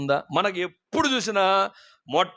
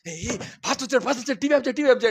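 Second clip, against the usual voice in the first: rising, hurried alarm; panicked urging; 6 semitones higher than usual. Retold or imitated. Mother telling daughter to switch off the TV, panicked urging. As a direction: hiss at her to hurry.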